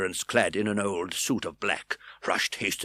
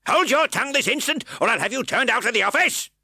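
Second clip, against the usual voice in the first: much higher, louder threat; furious magistrate; 7 dB louder than usual. A narrator's reading. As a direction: threaten to throw him out. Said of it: "said Mister Fang".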